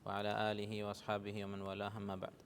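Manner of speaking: soft, low recited Arabic blessing; opening invocation. Real, not acted. Teacher opens the Arabic lesson with a quiet recited blessing. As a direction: murmur the opening prayer quietly and evenly.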